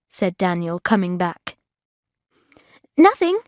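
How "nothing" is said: quick, high, caught-out denial; quick reply. A narrator's reading. Bridget caught searching Daniel's room, quick guilty reply. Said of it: "I said quickly".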